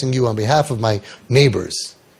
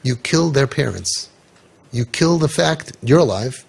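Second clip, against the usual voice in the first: usual speaking voice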